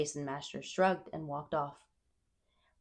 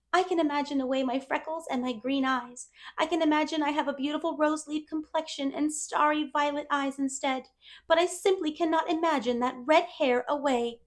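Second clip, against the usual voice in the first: put-on higher, eager girl voice; dreamy chatterbox girl; 9 semitones higher, 3 dB louder than usual. A narrator's reading. Anne telling Matthew about dresses, wistfully eager.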